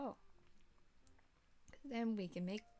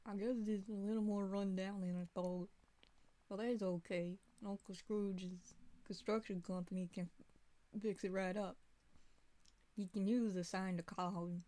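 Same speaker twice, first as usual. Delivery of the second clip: put-on folksy southern accent. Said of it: "for Goofy I'm just doing, like, a southern accent".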